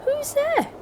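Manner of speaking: very high startled squeak; frightened mouse cry. A narrator's reading. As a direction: call out high like a scared mouse. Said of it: "Mouse cries".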